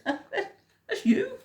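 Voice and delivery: very high, with a laugh. A narrator's reading. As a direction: laugh it out teasingly. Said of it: "And they laughed and they said".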